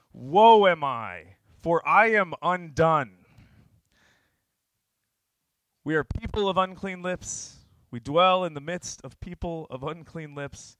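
loud, high, drawn-out confession; slow, full-voiced lament; anguished outcry. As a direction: cry out as one undone before God. Acted cry of being undone.

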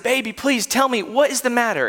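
high, tender, anxious pleading; worried father. Retold or imitated. Father asking his sobbing little girl what's wrong, high and tender. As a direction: coax a crying child to say what hurts.

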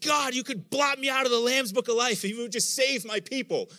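high, loud pleading Paul; a lightly voiced desperate plea; imitating Paul. Preacher voicing Paul begging God, high loud plea. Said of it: "He's like".

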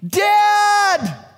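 put-on high, loud child's yell; a bullied kid calling for help; child shouting for dad. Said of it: "the kid on the playground".

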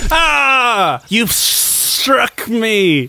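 high, slow dying gasp; a stricken serpent's cry; dying snake. Pretend. Snake struck by the sword.

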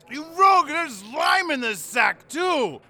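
loud, high angry shout. Acted. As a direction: yell at the serving boy. Falstaff shouts at the boy.

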